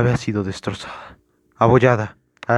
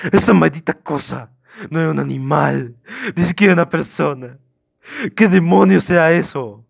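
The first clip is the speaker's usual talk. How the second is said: raised voice, climbing higher and louder; frantic outburst; 5 semitones higher, 5 dB louder than usual. Acted. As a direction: rant in horror, building to a yell. Suspect keeps yelling about the creature.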